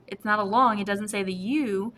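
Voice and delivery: ordinary delivery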